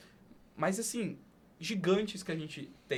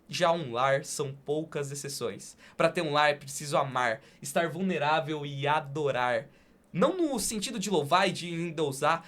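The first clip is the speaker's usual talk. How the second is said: poem reading; 5 dB louder than usual. Acted. Host closes the podcast by reciting a poem, earnest. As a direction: declaim it like a heartfelt poem.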